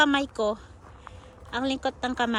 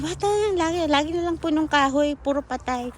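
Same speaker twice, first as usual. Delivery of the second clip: imagined viewer; 3 semitones higher, 6 dB louder than usual. Pretend. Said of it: "magwa-wonder kayo".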